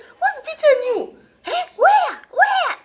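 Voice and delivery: very high, loud indignant questioning; shrieky mock outrage; loud mother. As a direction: imitate an outraged mum demanding names. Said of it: "loud woman".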